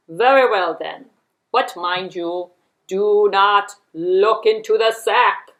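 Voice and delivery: loud, slow, emphatic fox warning; stern warning. A narrator's reading. Fox forbidding the lady to open the sack, stern and slow. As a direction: lay down the rule loudly, drawing out each word.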